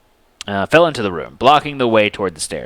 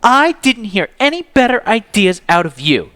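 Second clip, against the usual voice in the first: high, raised heated voice; angry; 11 semitones higher, 4 dB louder than usual. A narrator's reading. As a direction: fire back at him. Justice argues with Zeke.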